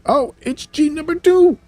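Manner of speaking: high-pitched mock amazement; surprised reveal. Telling viewers who the angelic figure is, surprised reveal. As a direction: leap up in pitch on the discovery.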